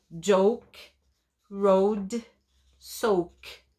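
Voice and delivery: "Joke, road, soak" slow and clear, each word drawn out; teacher modeling words. Shown as a demonstration. Teacher models Joke road soak slowly for the class. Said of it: "teacher repeats rhyming words".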